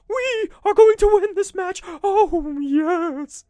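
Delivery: very high, loud, creepy manager wail; Paul Bearer voice. Retold or imitated. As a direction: promise the win in a high, creepy, hammed-up wail. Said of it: "he'd be like".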